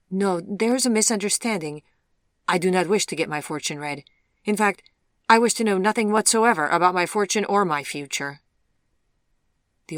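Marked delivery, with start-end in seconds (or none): 0.1-8.4 louder, raised-pitch insistent protest; firm refusal. Acted. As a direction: refuse firmly, brooking no argument. Uneasy man firmly refuses a reading.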